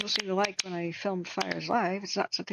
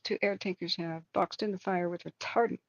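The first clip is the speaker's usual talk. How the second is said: ordinary delivery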